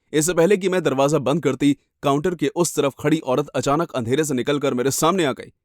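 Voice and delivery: ordinary delivery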